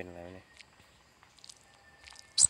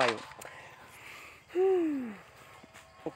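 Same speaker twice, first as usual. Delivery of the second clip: long defeated groan. Real, not acted. After dying in game.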